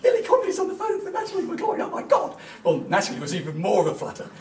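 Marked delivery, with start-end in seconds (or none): 0.0-2.4 much higher, excited gushing nurse; aflutter nurses. Retold or imitated. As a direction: gush like a starstruck nurse. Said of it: "the nurses were all, all aflutter".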